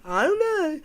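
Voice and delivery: high, helpless I-don't-know; whiny shrug. Retold or imitated. Mocking the boyfriend's shrug again, high and whiny. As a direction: whine it with a shrug. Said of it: "That's what he did".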